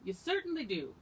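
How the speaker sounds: lightly voiced wife butting in; interrupting wife. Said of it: "interrupted his wife".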